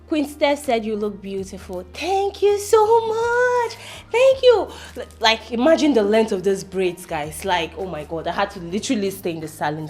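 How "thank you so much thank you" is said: gushing thanks. Acted. Streamer told by fan she looks beautiful, gushing. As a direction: gush over the compliment. Livestreamer gushes at compliment.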